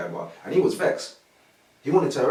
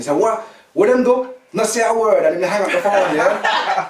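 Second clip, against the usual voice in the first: imitating a Jamaican speaker, pitched up; higher, animated Jamaican voice; Jamaican accent; 5 semitones higher, 4 dB louder than usual. Brother hears about the stolen phone, Jamaican accent. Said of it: "he talks like a Jamaican".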